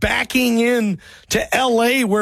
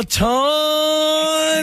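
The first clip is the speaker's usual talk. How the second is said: calling out; 5 semitones higher than usual. Real, not acted. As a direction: call his name to wake him. Host calling a sleeping caller.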